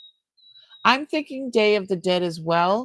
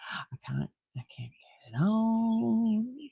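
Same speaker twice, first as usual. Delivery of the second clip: slow, unvoiced whisper to yourself; whispered to self; 6 dB quieter, slower than usual. Real, not acted. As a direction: whisper it while struggling, slowly. Muttering while fumbling with item.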